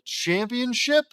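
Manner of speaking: high, drawn-out questioning CHAMPIONSHIP; incredulous query. Real, not acted. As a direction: question the word "Championship" with a rising, disbelieving tone. Reader questions the word just read.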